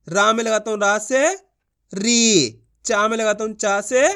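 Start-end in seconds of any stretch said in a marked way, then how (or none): 1.9-2.6 री with a long, sustained ee; drawn-out long ee. Shown as a demonstration. Teacher models the syllable for learners, vowel drawn out. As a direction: hold the ee in री. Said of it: "खींच खींच के पढ़ेंगे".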